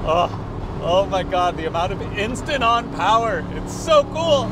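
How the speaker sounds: rising to a loud high cry; amazed wow. Real, not acted. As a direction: thrill building to a peak. Instant power, thrilled.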